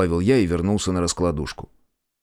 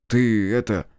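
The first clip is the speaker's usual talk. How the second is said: ordinary delivery, nothing marked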